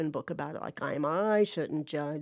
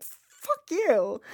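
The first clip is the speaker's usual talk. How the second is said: mock fuck-you; 9 semitones higher than usual. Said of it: "I was just like".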